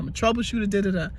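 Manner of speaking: ordinary talk, nothing marked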